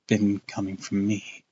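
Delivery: ordinary delivery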